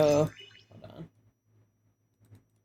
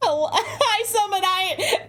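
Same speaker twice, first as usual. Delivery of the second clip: laughing, higher greeting; 8 semitones higher than usual. Real, not acted. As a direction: giggle out the greeting.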